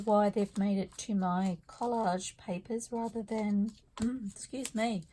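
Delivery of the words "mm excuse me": ordinary talk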